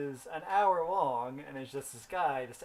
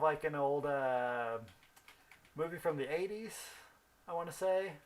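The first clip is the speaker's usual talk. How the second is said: normal talking voice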